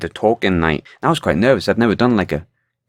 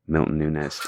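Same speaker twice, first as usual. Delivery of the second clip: low, soft, deadpan name drop; low announcement; 5 semitones lower, 7 dB quieter than usual. Retold or imitated. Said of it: "he got the mic and he went".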